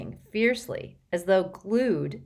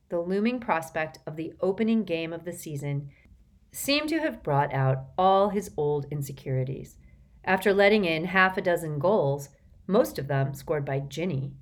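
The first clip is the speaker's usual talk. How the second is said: ordinary delivery, nothing marked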